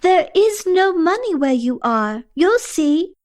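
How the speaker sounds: high-pitched calling voice. A narrator's reading. Asha answers you as she floats upward, calling out. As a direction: call it down from a distance.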